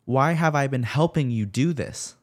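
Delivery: high, loud desperate demand; screaming demand. A narrator's reading. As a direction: scream it at him in disbelief. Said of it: "She screamed and demanded".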